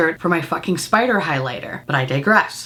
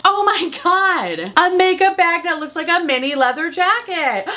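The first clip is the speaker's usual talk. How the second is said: excited gush; 7 semitones higher than usual. Retold or imitated. Buyer thrilled by the mini jacket bag, higher and gushing. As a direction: gush like you just found the perfect thing. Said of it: "I was like".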